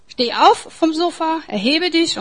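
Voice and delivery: rousing call. Acted. Preacher urges the listeners to rise.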